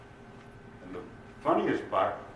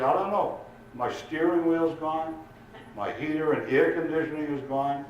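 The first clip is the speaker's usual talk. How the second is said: put-on distressed drunk caller; 6 semitones higher than usual. Drunk lists losses to police.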